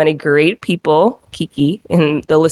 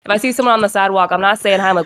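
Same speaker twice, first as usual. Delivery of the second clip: normal talking voice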